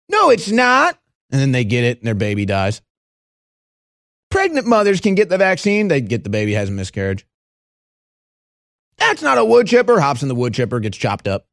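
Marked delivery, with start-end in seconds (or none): 0.1-1.0 high, dismissive mock voice; a sneering high "no"; mocking denial. Mocking denier's reply. 4.3-5.9 mock official line. 9.0-10.0 high smug denial; mocking stubborn denier. Callback to mock denier.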